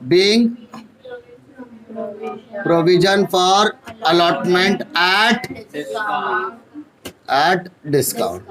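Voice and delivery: slow narration dictation with pauses. Shown as a demonstration. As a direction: dictate slowly, pausing between phrases. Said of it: "और यहाँ पर". (Narration रुक-रुक कर लिखवाना)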